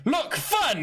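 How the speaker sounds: high excited squeal. Retold or imitated. Imitating the Doctor dancing at a wedding, high squeal. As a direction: squeal it out like a giddy kid.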